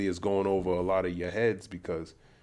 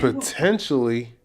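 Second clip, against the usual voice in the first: raised, louder, challenging pitch; incredulous echo; 4 semitones higher, 8 dB louder than usual. Real, not acted. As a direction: throw it back in disbelief. Challenges the clip's vague answer.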